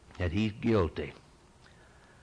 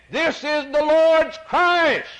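loud, high and slow declaration; solemn proclamation; 8 dB louder, slower than usual. Acted. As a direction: proclaim it slowly like the priest Simeon. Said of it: "your priest Simeon said".

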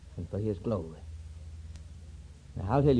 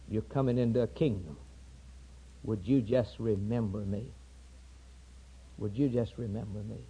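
humble plea; 3 semitones lower, 9 dB quieter than usual. Acted.

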